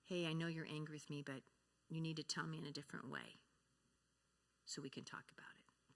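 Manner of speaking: soft, slowing down to a whisper; calm the angry child gently; calm reassurance. Shown as a demonstration. A parent gently answering a child who says he hates her, soft and reassuring.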